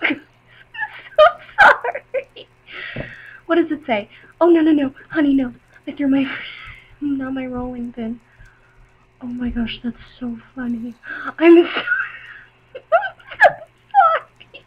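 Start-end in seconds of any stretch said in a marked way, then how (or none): 0.0-2.5 laughing apology. Real, not acted. 11.4-14.6 high, broken apology through laughs; helpless laughter. Real, not acted.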